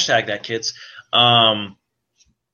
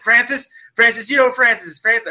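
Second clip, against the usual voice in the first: higher, taunting sing-song call for Francis; mock Deadpool; 11 semitones higher, 3 dB louder than usual. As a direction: tease him as you hunt. Imitating Deadpool calling Francis.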